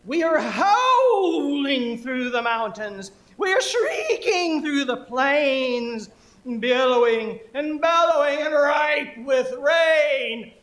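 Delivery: howling, high, loud incantation; poem performance. Acted. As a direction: bellow it slowly with long, stretched vowels. Performs the poem as rain gods.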